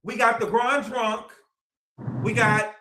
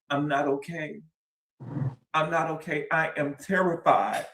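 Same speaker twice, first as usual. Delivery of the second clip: mimicking Wendy's shift; 6 semitones lower, 3 dB quieter than usual. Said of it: "changed her tone".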